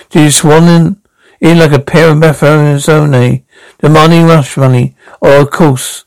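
ordinary talk, nothing marked

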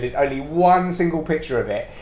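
normal talking voice

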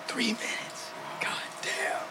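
breathless whisper. Real, not acted. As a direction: whisper it in awe. Reacting to the cold bath.

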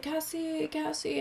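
high-pitched, calling her name twice; high mock call. Villa owner banging on her door at night, high mock call. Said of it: "and he's like".

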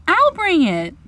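put-on high, loud girlish offer; eager little girl. A narrator's reading. Wendy glad to be of service, eager girl's voice. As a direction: jump in, glad to help. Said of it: "always glad to be of service".

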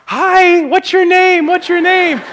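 a preacher mimicking his kids calling to a stranger; imitating little kids. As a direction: pipe it up like eager little kids.

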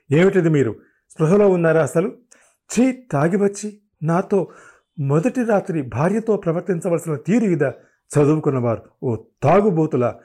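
angry words tumbling out; agitated outburst. A narrator's reading. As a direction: scold a drunken husband. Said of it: "విజయకి ఆవేశంలో మాట తడబడింది".

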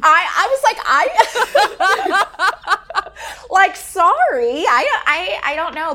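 high, loud nervous laughter through the words; awkward laugh. Retold or imitated. Teacher squirming before her principal, laughing nervously.

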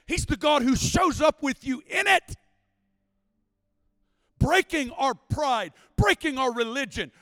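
loud, high, driving sermon climax; full-force preaching. Acted. Preacher to congregation about breaking religion, full-force preaching. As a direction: preach it out with rising fire.

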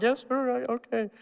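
put-on tearful child. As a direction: sound like a scolded crying kid. Crying child answers father.

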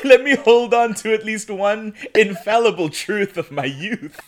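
loud plea, laughing through it; mock plea with a laugh. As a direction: beg playfully, laughing. Joking plea about lost truths.

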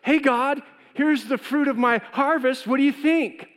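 high, loud, casual hey God; breezy offering. Retold or imitated. Abel cheerfully presenting his sheep to God, casual and high. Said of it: "Cain comes up and goes".

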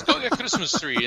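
high put-on accent, bossy; [name]'s voice. Boss sending employee for a tree, high put-on voice. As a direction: order him out for a tree.

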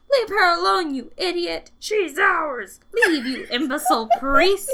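loud, high snarling demon; possessed demon taunt. Retold or imitated. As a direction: shout it like a possessed woman. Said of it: "threats and taunts such as".

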